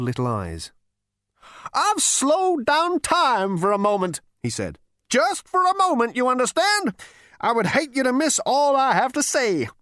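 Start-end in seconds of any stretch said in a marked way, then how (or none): 1.7-9.7 a sly ghost savoring the moment; old ghost voice. A narrator's reading. The ghost speaks.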